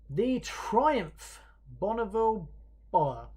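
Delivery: high, punchy announcement, name by name; winner reveal. Real, not acted. Host reveals the cruiser winner, big announcer delivery. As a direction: separate each word for a grand reveal.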